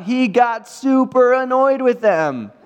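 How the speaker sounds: playfully exasperated storyteller; mock annoyed. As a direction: drag it out like you're fed up. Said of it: "that's not really what it says".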